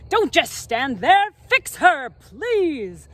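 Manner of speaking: loud, high angry demand; thundering mother. Acted. Mother yelling at the magician to fix her daughter, furious. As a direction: scold the wizard at full force. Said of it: "Mother thunders at the wizard".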